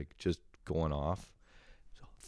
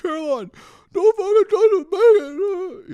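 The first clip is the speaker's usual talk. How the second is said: mock scream; 14 dB louder than usual. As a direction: scream her name to stop her. Screaming at sister.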